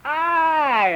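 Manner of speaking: put-on high, drawn-out toddler announcement; little toddler. Farquhar performing for Daddy, high toddler call. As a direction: proudly show off one word like a toddler. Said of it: "stands there and goes".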